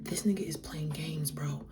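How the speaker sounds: whispered aside to oneself; private thought. Retold or imitated. Woman thinking to herself that he's playing games, whispered. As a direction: drop to a suspicious whisper, as if thinking. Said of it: "I'm thinking to myself".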